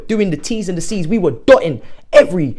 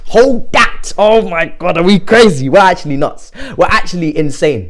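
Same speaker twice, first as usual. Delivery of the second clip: ordinary talk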